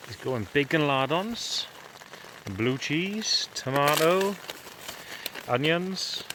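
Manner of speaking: ordinary talk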